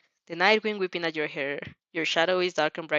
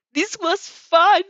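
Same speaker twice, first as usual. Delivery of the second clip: high, loud, stretched-out glee; childlike delight, drawn out and loud; delighted exclamation; 11 semitones higher, 9 dB louder, much slower than usual. A narrator's reading. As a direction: exclaim it, thrilled. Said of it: "she explains".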